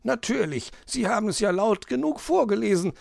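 high magpie voice, quick and teasing; cheeky retort. Acted. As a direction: tease the fox back as the magpie. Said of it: "erwiderte die Elster".

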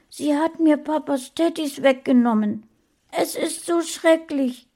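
hoch, laut, aufgelöst; Kind in Not. A narrator's reading. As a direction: klag voller Not. (hohe, aufgelöste Kinderstimme)

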